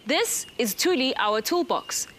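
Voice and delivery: ordinary talk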